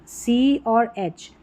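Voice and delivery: slow, letter by letter; spelled out slowly. Shown as a demonstration. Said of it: "यहाँ पर ये C H रहेगा".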